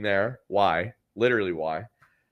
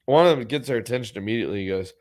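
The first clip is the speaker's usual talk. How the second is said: usual speaking voice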